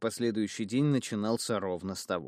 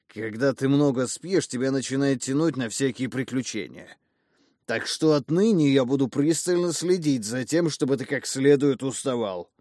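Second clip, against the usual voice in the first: loud lecturing; 5 semitones higher, 8 dB louder than usual. Acted. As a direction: lay down the law loudly. Drunk father lectures loudly.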